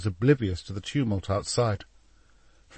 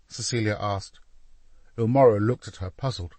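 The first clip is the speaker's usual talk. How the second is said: ordinary delivery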